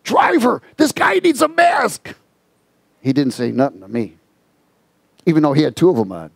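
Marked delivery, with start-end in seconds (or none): 0.0-2.1 high, slow mock shout; tattling stranger. Stranger calling out to the driver, high mock shout. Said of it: "he goes".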